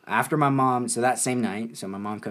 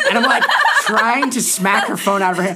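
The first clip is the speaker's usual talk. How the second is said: higher and louder, laughing through it; 11 semitones higher, 10 dB louder than usual. Real, not acted. Telling friends about his mom's phone, laughing. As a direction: break up laughing while telling it.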